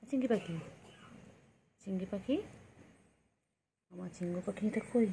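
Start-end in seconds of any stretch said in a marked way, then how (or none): none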